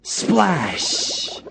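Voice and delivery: high, playful splash; splash sound word. Acted. Ball falls into pond.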